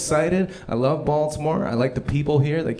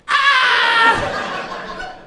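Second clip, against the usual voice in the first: high, loud comic scream; hammed-up shriek of terror; mock panicked scream; 7 dB louder than usual. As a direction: scream as if losing it completely. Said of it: "she just loses it".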